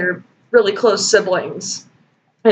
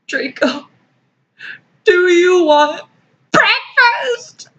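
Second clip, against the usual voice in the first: mock broken tone; 9 semitones higher, slower than usual. Mocking Bella's broken tone in fan fiction, crying. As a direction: voice climbing higher into a mock cry. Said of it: "in this broken tone".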